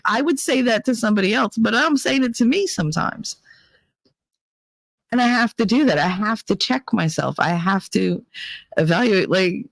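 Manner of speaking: ordinary talk, nothing marked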